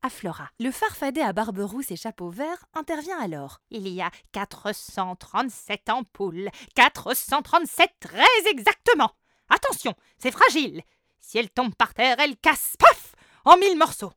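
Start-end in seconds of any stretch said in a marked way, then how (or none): 3.7-14.1 rising, louder fretful sprite; fussy sprite. A narrator's reading. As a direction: warn anxiously, getting louder. Fussy goblin with ornament. (farfadet pointilleux et inquiet)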